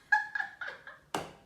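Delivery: a high, breathless burst of laughter; breathy laugh. Real, not acted. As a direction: laugh with almost no voice. Laughs at own retort.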